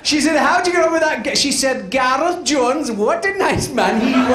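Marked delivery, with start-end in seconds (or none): 0.4-1.4 put-on quick, high gossiping old lady; high old woman, hurried. Old woman quickly asking her friend. 1.9-4.3 put-on high, slow, fond old lady; slow dreamy old woman. Old woman recalling a man, slow and fond.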